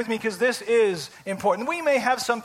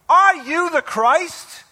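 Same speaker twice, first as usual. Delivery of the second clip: slow, loud, raised demand; exasperated high priest; 5 semitones higher, 5 dB louder, slower than usual. Retold or imitated. Preacher voices the high priest questioning Jesus, exasperated. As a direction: press the question hard, leaning on each word. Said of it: "like, beside himself and says".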